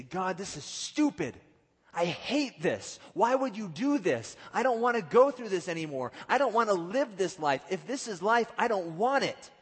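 high, loud railing at God; dramatized outburst of frustration; acted angry protest. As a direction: cry out in exasperation, hammering each complaint. Acts people complaining angrily to God.

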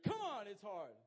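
high, loud plea for understanding; loud protest. Real, not acted. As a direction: protest loudly in self-defence. Protesting, admitting sleeping in prayer.

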